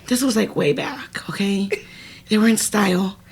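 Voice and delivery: a quick confidential whisper; whispered aside. Real, not acted.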